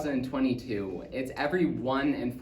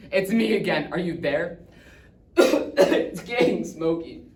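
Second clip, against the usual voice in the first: louder, halting speech broken by coughs; coughing, strained reassurance; 5 dB louder than usual. Acted. Dad phoning home as it gets smoky, strained. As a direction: insist all is fine through the smoke.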